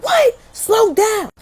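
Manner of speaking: very high, sharp mom imitation; confused mama. Mama halts babbling caller, high confused shout.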